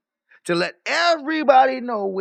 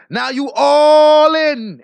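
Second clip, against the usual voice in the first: louder and very slow, every word stretched; drawn-out loud taunt; 4 semitones higher, 9 dB louder, much slower than usual. Real, not acted. Streamer gloating at Biz, slow loud drawn-out taunt. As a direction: lean into it slowly, savoring the taunt.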